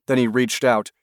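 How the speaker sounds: plain reading voice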